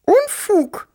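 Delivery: hoch gepiepst und geflüstert; piepsend. Acted. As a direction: ein empörtes Piepsen. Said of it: "piepste die Wawuschelgroßmutter". (Großmutter piepst dem Onkel ein Nein zu)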